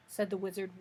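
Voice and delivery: plain reading voice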